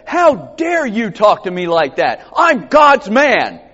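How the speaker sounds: loud, high, hammed-up outrage; mock indignant outburst. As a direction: puff up in mock offence, slowing on the last words. Mock angry reply Moses didn't give.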